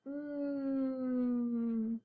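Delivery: "Mm" hummed with closed lips; m sound. Shown as a demonstration. Teacher modeling the m sound to kids. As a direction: hum the letter m.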